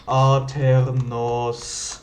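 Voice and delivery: drawn-out, syllable by syllable; slow spelled out. Shown as a demonstration. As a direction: spell it out slowly. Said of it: "W W W Punkt Aternos".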